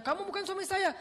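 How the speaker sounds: very high, loud denial; disbelieving wife. Acted. Wife to the young Ayub, high loud denial. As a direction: cry out he's not your husband. Wife denies him again.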